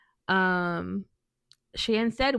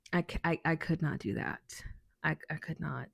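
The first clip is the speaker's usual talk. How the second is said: much softer, fading out; quiet; 3 semitones lower, 8 dB quieter than usual. Real, not acted. Telling coworker she couldn't stay, fading soft. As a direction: let it fade almost to nothing. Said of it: "trailing apology".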